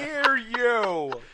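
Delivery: high, slow, puzzled squeak; high confused lackey. Acted. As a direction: squeak it out, baffled and laughing. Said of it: "Sozin's like".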